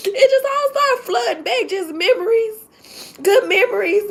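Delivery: tearful, voice breaking and high; fighting back happy tears; crying while talking. Real, not acted. Teacher tearfully telling viewers about meeting her old principal, crying. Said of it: "I'm so sorry to cry".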